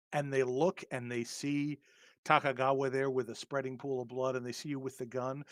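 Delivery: ordinary talk, nothing marked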